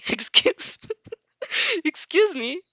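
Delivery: giggling through the words; can't hold back the laugh. Real, not acted. Narrator reading the heroine's line, giggling.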